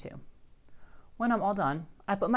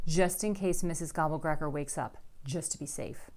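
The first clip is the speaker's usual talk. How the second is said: usual speaking voice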